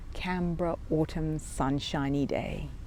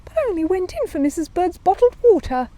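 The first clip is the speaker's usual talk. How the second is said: much higher, louder bear voice; Paddington protesting; 13 semitones higher, 6 dB louder than usual. A narrator's reading. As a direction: protest in the little bear's voice.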